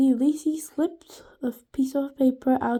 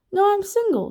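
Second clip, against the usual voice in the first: charming; 8 semitones higher, 4 dB louder, faster than usual. A narrator's reading. Pleasant gentleman voice.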